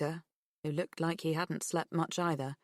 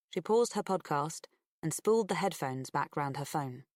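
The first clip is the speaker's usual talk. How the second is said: ordinary delivery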